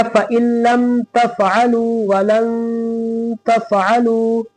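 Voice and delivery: slow, drawn-out chanted recitation; Quran recitation. Shown as a demonstration. Teacher reciting for students, slow and melodic. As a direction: recite slowly, stretching each vowel.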